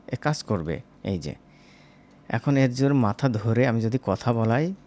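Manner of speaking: ordinary talk, nothing marked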